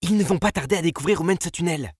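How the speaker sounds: fast, high, loud hiss; urgent warning, hissed and hurried; hissing urgency. A narrator's reading. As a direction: rush it out in a sharp sibilant voice. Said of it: "d'une voix sifflante".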